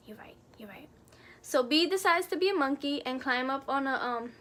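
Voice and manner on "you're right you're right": soft whispered repeat; whispered concession. Real, not acted. Giving in to her friend, whispered. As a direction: whisper the agreement to yourself.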